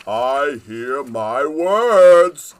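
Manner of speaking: loud, very slow booming voice; great king tree booming with joy. Acted. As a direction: boom it out like joyful thunder. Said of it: "His voice was like an earthquake of joy".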